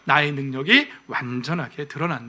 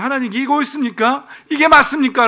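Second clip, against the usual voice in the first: high-pitched protesting questions, growing louder; complaining; 7 semitones higher, 6 dB louder than usual. Acted. Habakkuk at first grumbles to God, complaining protest. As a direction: challenge God with a grievance. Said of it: "처음에는 불평불만을".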